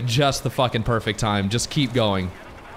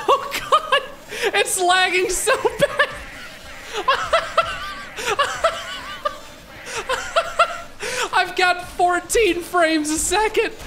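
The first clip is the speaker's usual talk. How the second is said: high, breaking into laughter; laughing. Real, not acted. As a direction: laugh helplessly at the lag. Laughing at lag.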